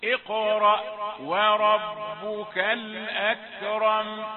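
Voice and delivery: slow, melodic, sustained chanting; Quranic recitation. Acted. Teacher recites the sura for students to follow, slow chanting. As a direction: chant each verse slowly and let it ring.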